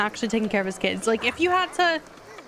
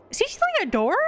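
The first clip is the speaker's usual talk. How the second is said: baffled squeak; 8 semitones higher, faster than usual. Real, not acted. Baffled at what the burglar takes.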